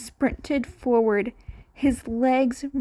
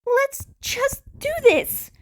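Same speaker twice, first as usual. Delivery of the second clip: much higher, urgent cut-in; a nervous boy cutting the debate short, higher and urgent; 14 semitones higher, 3 dB louder than usual. Acted. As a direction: jump in before anyone argues. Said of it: "Izuku interrupted".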